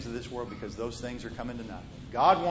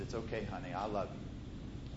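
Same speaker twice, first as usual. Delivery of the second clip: tender whisper; 6 semitones lower, 9 dB quieter than usual. Retold or imitated. Father comforts daughter at bedtime.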